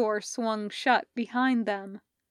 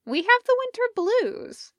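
Parker's voice; 12 semitones higher, 4 dB louder than usual. Acted. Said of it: "she said".

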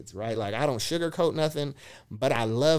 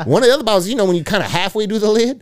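excited retelling; 8 semitones higher, 12 dB louder, faster than usual. Real, not acted. Retelling, still laughing, voice up.

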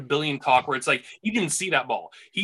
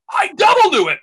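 triumphant outburst; 11 dB louder than usual. Retold or imitated. Topping his own retold retort.

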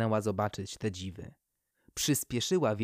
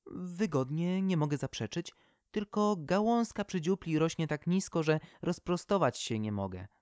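lightly voiced tree, a touch of weary complaint; a cramped old poplar grumbling gently; Poplar tree complaining; 6 semitones higher than usual. The poplar grumbles to the owl about her cramped branch.

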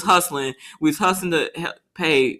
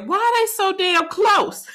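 high, incredulous question; can't believe how close they drive; alarmed; 12 semitones higher than usual. Retold or imitated. Wife questioning the swerving van, high incredulous. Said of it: "I said".

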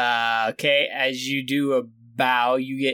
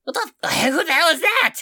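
startled; 12 semitones higher, 3 dB louder than usual. Acted. Startled guard.

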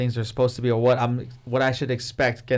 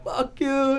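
much higher, a hint of a mad friend; mock angry comeback; 11 semitones higher than usual. Imitating riled-up friend, mock angry retort. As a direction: snap back in jest. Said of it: "He get mad".

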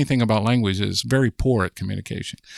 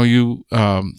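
ordinary delivery

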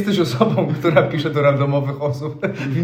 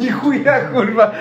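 laughing disbelief; 8 semitones higher, 6 dB louder than usual. Real, not acted. Reacting to acid advice post.

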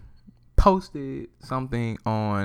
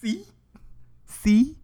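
triumphant; 14 semitones higher than usual. Real, not acted. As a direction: crow "See?" like you called it. Gloating repeat.

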